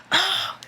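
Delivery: high, exasperated groan; frustrated groan. Real, not acted. Reader overwhelmed by the retold fight, frustrated groan.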